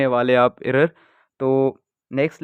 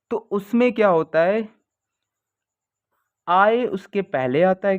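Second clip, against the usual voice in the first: ordinary talk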